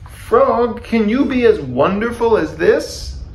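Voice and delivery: high, loud show-off question; boastful calling. A narrator's reading. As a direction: call down proudly from the stage. Toad boasts to Frog from stage.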